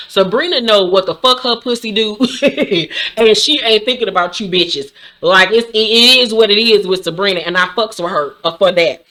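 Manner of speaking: ordinary talk, nothing marked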